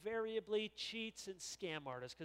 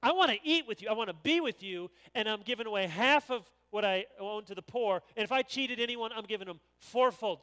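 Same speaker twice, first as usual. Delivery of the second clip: loud, high pledge to the Lord; fervent loud vow; 4 semitones higher, 14 dB louder than usual. Acted. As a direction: declare it with full conviction. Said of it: "he said".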